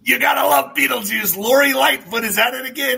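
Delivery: mocking shout. Real, not acted. Host jeering at the mayor for his audience, high mocking shout.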